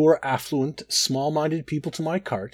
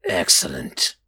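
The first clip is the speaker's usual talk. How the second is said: very low, rasping satisfaction; 10 semitones lower, slower than usual. A narrator's reading. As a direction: rasp it out deep and sinister. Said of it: "he rasped".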